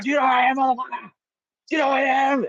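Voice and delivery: high, strained mock shout; hammed-up aggressor yelling in someone's face; confrontational imitation. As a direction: act out an outraged, high-pitched rant. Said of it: "he's in my face".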